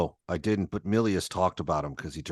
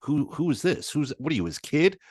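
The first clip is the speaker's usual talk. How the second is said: surprised; 7 semitones higher than usual. Retold or imitated. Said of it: "they're like".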